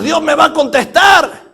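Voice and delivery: high, loud cry of certainty; insistent shout. Acted. Worshippers who hurt themselves to get an answer, insistent shout.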